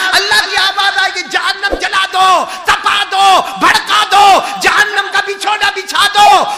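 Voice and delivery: high, loud shouted judgement; thundering preaching. Acted. Preacher shouting the voice from heaven at the sinner. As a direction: thunder it at the crowd.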